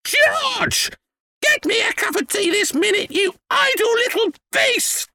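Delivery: put-on very high, screeching old woman; screechy, bossy harridan; grandma's angry yell. A narrator's reading. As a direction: shriek orders and insults. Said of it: "Grandma yelled".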